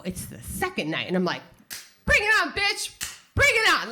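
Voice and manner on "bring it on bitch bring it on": pumped. Retold or imitated. Psyched up for second night.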